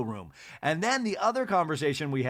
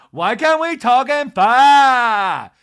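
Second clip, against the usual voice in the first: much higher, louder, slow mock scream; imitating Lisa's scream; 10 semitones higher, 16 dB louder, much slower than usual. Imitating Lisa screaming at the women, mock scream. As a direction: shriek it, drawn out. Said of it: "But she's like".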